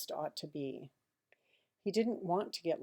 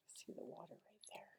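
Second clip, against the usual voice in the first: barely voiced pointing aside; soft whispered aside; 2 semitones higher, 21 dB quieter than usual. A narrator's reading. Narrator whispering to the child about the picture. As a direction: lean in and whisper it.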